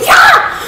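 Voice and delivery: high, loud mock fizz of a can; soda fizz noise. A shaken soda can spraying in Larry's face, mock fizz. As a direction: make the can go pop and fizz.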